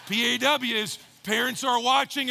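normal talking voice

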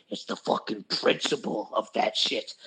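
low, whispery voice in the head; nagging inner voice. Retold or imitated. Retelling the second voice in his head, low whisper. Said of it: "in the back of that voice".

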